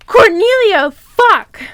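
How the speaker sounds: high, loud exclamation; horrified outburst. Real, not acted. Reader shocked by the villain's arrival, loud yelp. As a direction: yelp it out in dismay.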